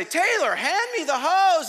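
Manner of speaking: high, loud, slowed-down demand; exasperated holler. Retold or imitated. Man under the burning porch to Taylor, exasperated high holler. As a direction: holler each word out urgently.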